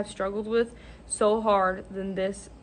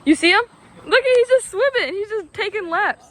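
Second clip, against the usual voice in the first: excited; 10 semitones higher, 7 dB louder than usual. Real, not acted. Watching the mouse swim.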